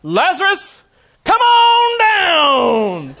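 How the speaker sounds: high, drawn-out game-show style holler; loud cry. Acted. Preacher as Jesus jokingly summoning Lazarus from the tomb, huge shout. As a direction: call out at full force, stretching the last words. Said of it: "In a loud voice, he cried out".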